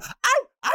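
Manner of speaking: high mock dog howl, ow ow. Howling like a dog.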